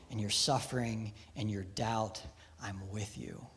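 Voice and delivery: soft, low, tender promise; reassuring voice of God. Acted. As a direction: speak it gently, like comfort in the dark. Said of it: "we hear the reassuring voice of God".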